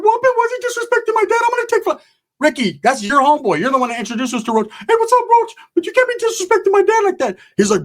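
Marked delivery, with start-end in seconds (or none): 0.0-2.0 very high, fast whiny voice; squeaky complaining youngster; mock high-pitched whine; whiny kid voice. Voicing Ricky whining. 4.7-7.4 very high, fast whiny voice; squeaky complaining youngster; mock high-pitched whine; whiny kid voice. Voicing Ricky again.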